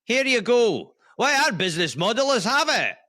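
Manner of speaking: high, generous Scottish merchant; Scottish accent. Acted. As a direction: ham up a high-pitched Scots shopkeeper. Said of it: "do you do a Scottish accent".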